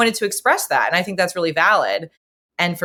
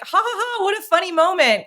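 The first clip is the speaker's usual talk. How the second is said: amused; 13 semitones higher than usual. Retold or imitated. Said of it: "I was feeling like".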